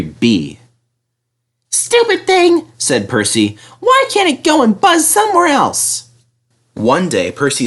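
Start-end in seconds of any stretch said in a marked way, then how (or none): 1.7-6.0 much higher, chirpy small engine; little engine voice. Acted. Percy grumbles at the helicopter in his high voice.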